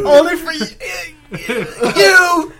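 high squeal breaking into laughter; laughing shout. Pretend. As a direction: cry it out, cracking up. Mock sweet reply while laughing.

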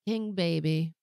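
ordinary talk, nothing marked

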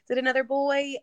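high, playful tease of her husband; high-pitched teasing question to husband; silly teasing. Retold or imitated. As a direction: needle him in a jokey singsong. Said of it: "kinda being silly back and forth".

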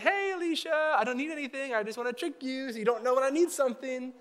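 exaggerated high-pitched wheedle; I-need-something voice. Pastor tricking his coworker with a mock high wheedling voice. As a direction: put on a high voice, hammed up to trick her. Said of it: "That's your I need something voice".

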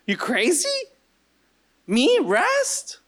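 high-pitched mock disbelief; incredulous objection. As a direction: squeak the question upward in disbelief. Same objector, incredulous at resting.

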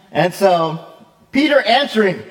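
ordinary talk

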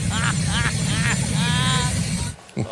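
hearty laugh out loud; big laugh. Real, not acted. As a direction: burst out laughing. Laughs at clip.

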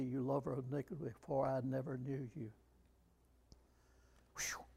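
normal talking voice

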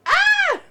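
loud, high-pitched scream; delighted shriek. Real, not acted. Guest reacting to a church joke, shrieking.

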